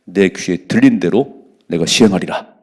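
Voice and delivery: loud, slow, solemn reading; scripture reading. Acted. Preacher reading the verse loudly to his congregation. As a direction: proclaim the verse slowly and heavily.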